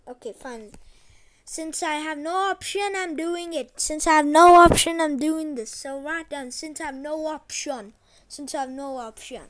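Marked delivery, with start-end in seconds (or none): 3.8-5.7 loud complaint. Real, not acted. Grumbling about forced choice.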